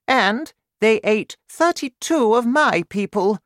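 high, loud regal voice; emphatic Queen. A narrator's reading. Queen telling the King of her losses, high and emphatic. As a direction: declare it as a queen, high and forceful.

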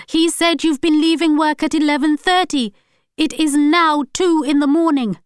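loud, high angry voice; furious shouting. A narrator's reading. Wife confronting husband about his lies, shouting. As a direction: accuse him relentlessly.

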